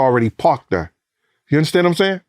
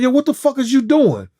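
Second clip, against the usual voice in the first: indignant outburst; 10 semitones higher than usual. Retold or imitated. Complaining about seat pushed back.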